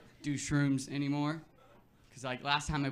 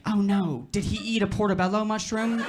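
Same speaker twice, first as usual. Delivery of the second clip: high, louder fretful lady voice; mock alarmed receptionist; 5 semitones higher, 7 dB louder, slower than usual. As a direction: play the worried front desk lady.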